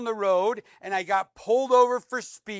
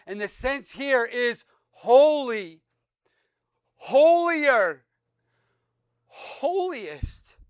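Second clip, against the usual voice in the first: rising higher and louder on holy, holier, holiest; each form of "holy" pushed up in pitch; building emphasis; 3 semitones higher, 5 dB louder, much slower than usual. Shown as a demonstration. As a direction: climb step by step to "holiest". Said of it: "builds holy to holiest".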